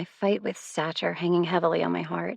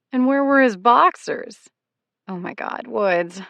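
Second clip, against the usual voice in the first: amused question; 5 semitones higher, 7 dB louder, slower than usual. A narrator's reading. Ex delighted by the story.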